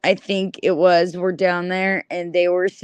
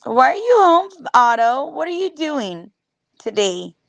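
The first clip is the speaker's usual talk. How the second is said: coaxing; 3 semitones higher than usual. Acted. Talking to Otto off-screen.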